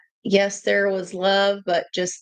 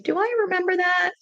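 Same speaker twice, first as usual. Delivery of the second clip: much higher, incredulous questioning; retold disbelief; 8 semitones higher, faster than usual. Telling viewers she doubts Marvin said that, incredulous and high. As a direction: act baffled that you don't remember it. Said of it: "I'm like".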